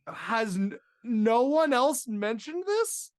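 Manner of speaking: loud, high, drawn-out disbelief; exasperated yell of a question; incredulous outburst. Acted. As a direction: stretch it out, losing it at your friend. Acted disbelief, losing it at friend.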